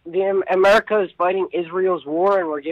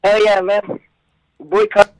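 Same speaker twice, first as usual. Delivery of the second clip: loud caller over a phone line; 3 semitones higher, 9 dB louder, slower than usual. Real, not acted. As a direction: shout it down the phone.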